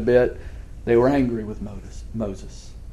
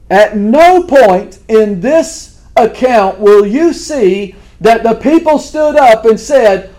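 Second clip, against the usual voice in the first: full-force preaching; 9 semitones higher, 9 dB louder than usual. Acted. Pastor urging his congregation, loud and fervent. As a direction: preach it hard to the congregation, building.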